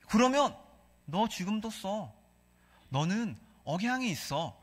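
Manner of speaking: normal talking voice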